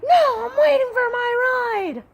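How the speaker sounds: high, loud, whining imitation of an angry daughter; squealing teen complaint, hammed up; whiny mock daughter. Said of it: "And she's like".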